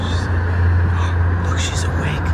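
gasping excited whisper; surprised whisper. Real, not acted. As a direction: gasp and whisper the news. She wakes suddenly.

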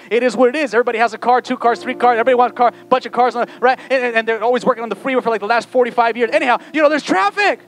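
loud, high, driving exhortation; full-force preaching. Real, not acted. Preacher rousing church to expect traffic on the way, fervent.

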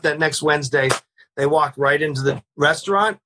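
ordinary talk

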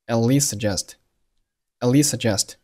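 "at least suggest" linked as at-lee-suggest, one s; slow linked "at least suggest", single s; merged S sounds. Shown as a demonstration. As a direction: drop both t bursts and share one s in "least suggest". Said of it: "咱们只保留一个就好了".

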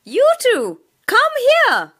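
high, loud calling out; teacher spotting Sam and Pam, calling. A narrator's reading. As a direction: call the two over. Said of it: "said the teacher".

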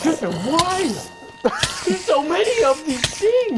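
overwhelmed cry. Real, not acted. Overwhelmed by monsters.

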